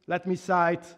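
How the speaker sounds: usual speaking voice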